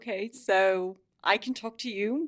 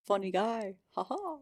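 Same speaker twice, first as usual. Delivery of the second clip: ordinary talk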